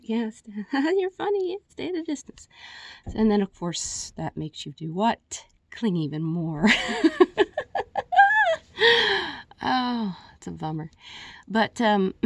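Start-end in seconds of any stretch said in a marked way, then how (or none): 0.0-2.5 high, fake-laughing fob-off; mock polite brush-off. Voices friends politely backing away. 6.7-9.6 long, high, loud burst of laughter. Real, not acted. Laughs at clinging even more.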